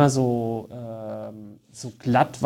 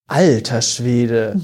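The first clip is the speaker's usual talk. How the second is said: very slow, lower „Alter Schwede“; drawn-out exclamation; 5 semitones lower, much slower than usual. Shown as a demonstration. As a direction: stretch out the idiom like an amazed saying. Quotes the idiom as example. (gedehntes, tiefes „Alter Schwede“)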